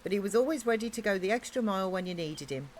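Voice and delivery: usual speaking voice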